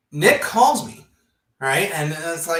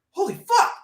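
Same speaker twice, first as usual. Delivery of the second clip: high, loud holy fuck; shocked outburst; shocked exclamation; 14 semitones higher, 6 dB louder than usual. Retold or imitated. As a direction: blurt it out in shock. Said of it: "I'm like".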